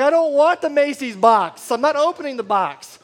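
high, loud yell of refusal; mock bawling tantrum. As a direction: holler it like a hammed-up crying kid. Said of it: "I'm just bawling my eyes out".

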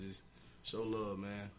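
usual speaking voice

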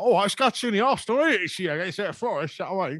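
high-pitched mock Southern English accent; put-on Southern accent. Two Northern fans blending in at away games, mock Southern accent. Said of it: "doing my Southern accent".

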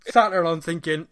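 ordinary talk

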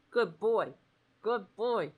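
ordinary talk

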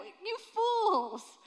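high, loud, scornful cry of Jesus; scornful rebuke. Acted. Jesus rebuking those who wrongly accused him, scornful high shout. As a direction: fling it at his accusers.

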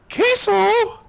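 very high squeaky Mickey "Queso?". Retold or imitated. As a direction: squeak it like the mouse posing for a photo. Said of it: "he goes".

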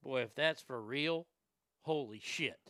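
ordinary talk

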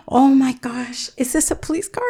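mock gasp of surprise at realizing it's a cop; startled realization. A blind woman realizes she's in a police cruiser, rising alarmed. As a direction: voice jumps up with alarm. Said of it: "I said".

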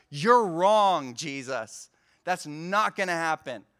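loud, sharp contradiction of Jesus; defiant retort. Retold or imitated. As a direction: push back hard, sure you're right. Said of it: "he's like".

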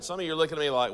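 ordinary talk, nothing marked

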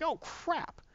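high, soft mock squeal; acted-out high-pitched alarm. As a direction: squeak it in sudden dismay. Boy realises danger, retold.

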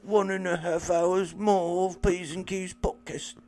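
high-pitched, pleading mock British; hammed-up British waif accent. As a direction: beg like a British orphan. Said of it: "Is that a good British accent?".

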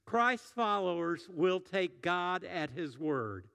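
slow emphatic sermon line; slow, deliberate declaration. Shown as a demonstration. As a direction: spell out the key sentence slowly. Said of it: "this is the sermon in a sentence".

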